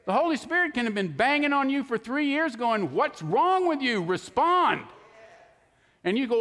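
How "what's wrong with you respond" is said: high, drawn-out acted scolding; mock-exasperated, pitched up and emphatic; exasperated demand. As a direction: demand an answer from someone ignoring you. Holy Spirit acted out, exasperated.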